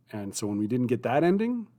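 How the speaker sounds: ordinary delivery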